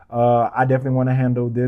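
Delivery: ordinary delivery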